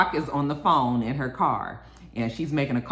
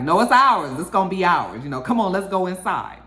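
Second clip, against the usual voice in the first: excited; 5 semitones higher, 5 dB louder than usual. Retold or imitated. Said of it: "So she's all excited".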